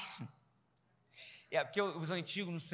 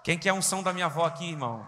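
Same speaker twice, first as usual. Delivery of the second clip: loud preaching cry; 2 semitones lower, 9 dB louder, faster than usual. Real, not acted. Preacher's loud closing exclamation.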